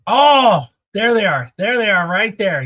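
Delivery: loud, hammed-up discovery; big "oh!" then an eager reveal; mock surprise. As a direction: burst out as if just spotting them.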